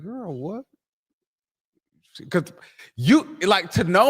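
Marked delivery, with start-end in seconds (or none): 0.0-0.6 mock surprise.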